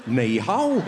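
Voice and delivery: low Geordie-accented Chinese. Shown as a demonstration. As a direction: greet in Chinese with broad Geordie. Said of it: "Chinese with a Geordie accent".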